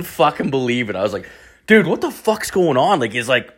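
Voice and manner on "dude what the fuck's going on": high, baffled "dude"; stunned disbelief. Retold or imitated. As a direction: voice jumps up in confusion. Stunned after leaving scene.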